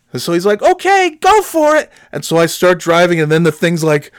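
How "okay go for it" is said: high-pitched trucker call; imitated calling out. Trucker sending novice driver off, imitated calling out.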